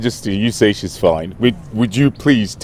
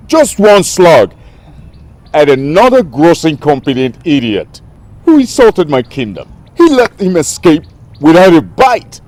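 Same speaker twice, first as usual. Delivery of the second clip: high-pitched, exasperated tirade; indignant, incredulous outburst; outraged rant; 6 semitones higher, 4 dB louder than usual. Acted. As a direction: vent about an incompetent servant, voice raised. Ranting about a fired bodyguard.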